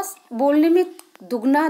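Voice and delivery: usual speaking voice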